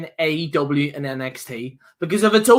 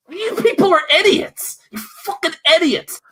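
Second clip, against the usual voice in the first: ranting yell, pitched much higher; fired up; 9 semitones higher than usual. Real, not acted. Podcaster rants at online critics in a high, furious yell. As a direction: blow up at them, voice jumping high. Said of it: "I am fired up here".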